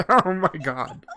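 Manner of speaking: laughing. Real, not acted. Host laughing hard.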